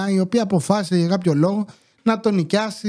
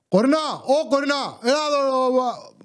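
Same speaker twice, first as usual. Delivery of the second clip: high-pitched calling out, without real volume; mock neighbour yelling; 6 semitones higher than usual. Neighbour calling his daughter Korina, in a high mock yell. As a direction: imitate a parent hollering for the daughter. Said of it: "τη φωνάζει ασταμάτητα".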